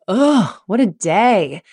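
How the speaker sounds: slow, weary groan of exasperation; drawn-out "ugh", letting the day out; venting. Acted. Tired parent venting to partner on getting home, exasperated. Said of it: "you wanna vent".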